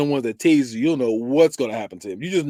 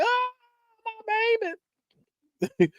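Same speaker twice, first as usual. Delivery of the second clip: high-pitched mock motherly wail; wailing mom; 4 dB quieter, slower than usual. Horror-movie mom losing her child, mock wail. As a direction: cry out high like a horrified mom. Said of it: "And Mom's like".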